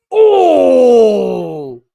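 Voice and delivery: loud, long falling "oh!"; shocked cry. Real, not acted. Reacts to a big spear.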